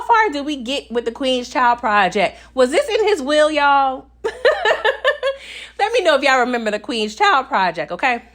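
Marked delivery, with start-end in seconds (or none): 4.2-5.7 a burst of high amused laughter; squealing giggle at the memory. Real, not acted. Laughs at forgotten plot thread.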